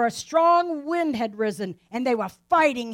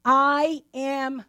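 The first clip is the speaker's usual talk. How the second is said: calm reassurance; much slower than usual. Acted. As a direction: soothe frightened men in a storm. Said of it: "he says".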